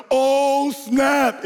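high, mock-panicked cry; alarmed exclamation. Middle brother flying down the stairs, mock alarm. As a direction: cry out as the sled takes off.

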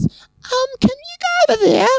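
very high, squeaky mock-woman voice; mocking snooty woman. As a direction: mimic her in an exaggerated falsetto. Mimics the rude friend in squeal.

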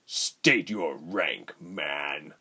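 very slow, icy demand; cold. A narrator's reading. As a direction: freeze him with each word. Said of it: "Rubens turned coldly".

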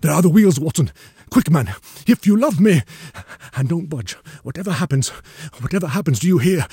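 high, loud, urgent orders; urgent command. A narrator's reading. Sick man hears wheels, urgently orders his friend to hide. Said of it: "sat up with a rigid intentness".

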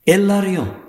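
high, drawn-out insistence; insistent demand. A narrator's reading. She insists after her high-voiced rebuke.

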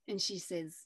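ordinary delivery

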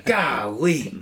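mock Kirk Cousins. Imitating Kirk on the sideline.

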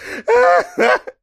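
high-pitched giggling burst; squeaky burst of laughter. Real, not acted. Laughing at his co-host's mock brag, high and bright.

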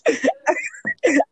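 high-pitched laughing burst. Real, not acted. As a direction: crack up helplessly. Laughs hard.

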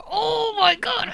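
high, slow, awestruck gush. Retold or imitated. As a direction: say it like you just saw something stunning. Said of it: "I was like".

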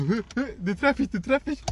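imitated excitement, climbing in pitch; acting out an eager player. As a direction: play the player lining up the ball. Acts out player chasing the ball.

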